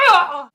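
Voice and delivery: loud exasperated "ugh"; frustrated groan. Real, not acted. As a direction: let out the frustration. Exasperated end of rant.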